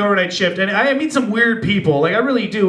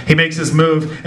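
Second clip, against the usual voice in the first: normal talking voice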